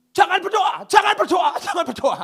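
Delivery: high, loud desperate begging demon; frantic shouted plea not to pray; pleading demon. Acted. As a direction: shout it like a panicked spirit begging. Demon pleading in preacher's story.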